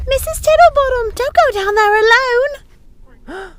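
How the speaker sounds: high, loud flustered maid voice; alarmed young housekeeper pleading; high excited servant's voice; Missus Pickle, urgent. Acted.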